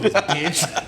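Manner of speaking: usual speaking voice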